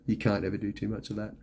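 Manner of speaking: ordinary talk, nothing marked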